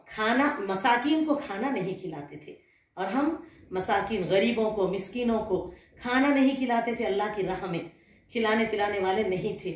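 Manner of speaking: normal talking voice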